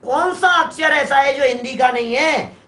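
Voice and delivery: ordinary talk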